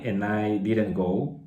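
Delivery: ordinary talk, nothing marked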